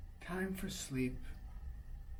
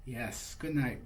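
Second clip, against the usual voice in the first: usual speaking voice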